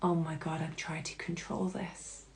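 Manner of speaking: very soft, low, near-whispered awe; acted whispery epiphany; hushed realization. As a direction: let it dawn on you under your breath. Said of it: "that moment of realization of going".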